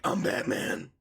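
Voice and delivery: low, rough superhero growl; hammed-up dark hero impression; Batman. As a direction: rasp it out in mock menace. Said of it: "And I'll just be like".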